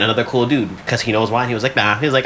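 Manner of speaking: ordinary talk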